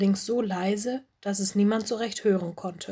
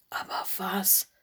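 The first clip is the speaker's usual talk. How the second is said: breathless awestruck whisper; stunned little wolf, barely voiced; stammering in awe. A narrator's reading. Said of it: "stammelte Mino".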